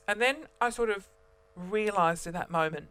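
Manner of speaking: ordinary delivery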